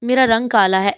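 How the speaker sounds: usual speaking voice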